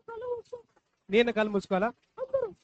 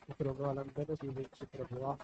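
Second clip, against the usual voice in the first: leading a prayer; 7 semitones lower, 8 dB quieter than usual. Acted. Adult leads prayer. (నెమ్మదిగా, మెత్తగా ప్రార్థన)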